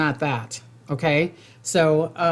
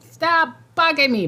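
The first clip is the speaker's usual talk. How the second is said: annoyed; 11 semitones higher, 4 dB louder than usual. Retold or imitated. Irritated person on the phone.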